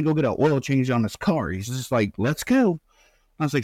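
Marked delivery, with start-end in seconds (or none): 2.2-2.8 a sudden bright, much higher go-ahead; eager son. Retold or imitated. Son eager to head off to radiation, high and upbeat. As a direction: chirp it out, keen to get going.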